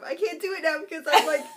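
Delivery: ordinary talk, nothing marked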